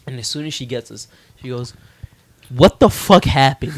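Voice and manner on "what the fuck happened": louder, drawn-out exclamation of disbelief; retold shocked outburst. Friend's mom picking up the hurt kids, shocked outburst. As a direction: stretch it out loudly, imitating a shocked mom.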